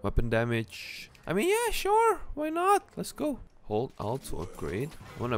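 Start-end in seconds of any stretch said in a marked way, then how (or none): 1.3-3.4 sarcastic high voice. Real, not acted. Sarcastic shop choice.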